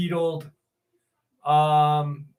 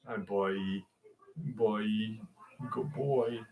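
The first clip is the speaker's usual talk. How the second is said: soft pet talk; 6 semitones lower, 11 dB quieter, much slower than usual. Real, not acted. Owner soothing his cat.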